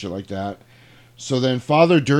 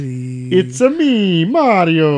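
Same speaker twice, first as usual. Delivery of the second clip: much higher, louder, slow hammed-up Mario; 8 semitones higher, 9 dB louder, much slower than usual. Joking the Italian note says, loud high Mario impression. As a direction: stretch it out like a cartoon Italian plumber.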